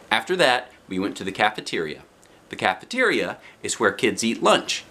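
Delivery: plain reading voice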